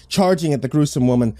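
plain reading voice

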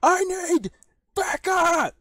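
high, loud shout for backup; amplified call. Acted. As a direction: yell it into the amplifier. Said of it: "they point that device".

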